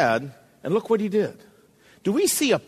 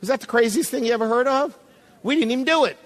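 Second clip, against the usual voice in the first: ordinary talk, nothing marked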